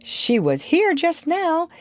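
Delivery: high, drawn-out, mildly impatient; a little impatient. A narrator's reading. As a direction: insist on it, slightly put out. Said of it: "he said a little impatiently".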